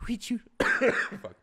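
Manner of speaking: a silly Sandler character asking sweetly; high, slow put-on Sandler voice; Adam Sandler impression. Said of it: "That's all I can do for Adam Sandler".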